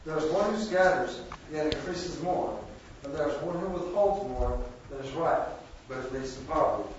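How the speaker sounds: usual speaking voice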